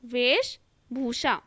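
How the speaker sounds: "वेशभूषा" as vesh, then bhusha; slow dictation. Shown as a demonstration. Teacher dictating to students, slow and segmented. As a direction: draw out "वेशभूषा" in two parts. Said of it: "वैसे ही".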